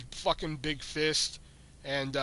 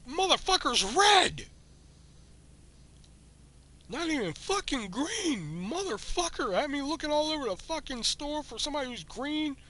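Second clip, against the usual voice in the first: furious rant; 10 semitones higher than usual. Retold or imitated. Clerk in the back room raging about the customer, high furious yelling. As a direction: rage at the customer who meant Hellboy. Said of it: "I was fucking furious".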